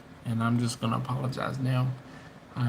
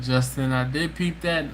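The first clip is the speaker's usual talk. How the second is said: ordinary talk, nothing marked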